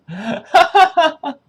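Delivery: high, loud burst of laughter; real high-pitched laugh. Real, not acted. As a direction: crack up in loud high peals. Laughing at own butter demo.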